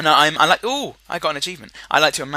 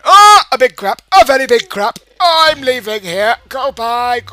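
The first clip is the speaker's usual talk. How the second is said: high, loud startled yelling; panicked yelling; 10 semitones higher, 14 dB louder than usual. Real, not acted. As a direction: yell in alarm while fleeing. Startled by danger, fleeing in game.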